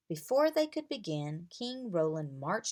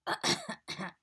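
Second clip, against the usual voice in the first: small embarrassed throat-clearing cough; awkward cough. A narrator's reading. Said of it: "King Roland coughed".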